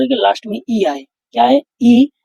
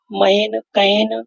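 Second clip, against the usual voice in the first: मैन and कैन stretched very slowly, open ae; teacher drills example words for students, very slow; slow model words; much slower than usual. Shown as a demonstration. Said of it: "तो ये क्या हो जाएगा?".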